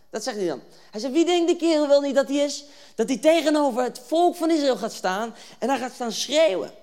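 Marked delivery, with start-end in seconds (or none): 1.1-6.7 raised, loud acted outrage; indignant. David reacting to the Philistine's taunts, indignant. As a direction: demand it with affronted force.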